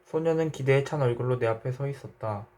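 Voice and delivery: plain reading voice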